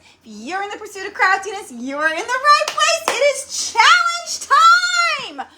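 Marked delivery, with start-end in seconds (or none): 3.1-5.5 sung out high and loud; singsong shouted intro; excited announcement. Real, not acted. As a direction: belt it out like a showhost. Livestream intro, announcing challenge.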